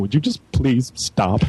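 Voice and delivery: slow, weary imitation of a crying mom; mock tearful plea. Teller voicing his crying mother begging him to stop. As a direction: plead slowly, worn out, as his crying mom. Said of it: "bowed down crying and said".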